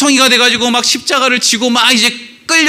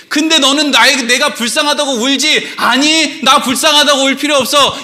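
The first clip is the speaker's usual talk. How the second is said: preacher voicing Jesus; 7 semitones higher than usual. Preacher acts Jesus addressing the weepers.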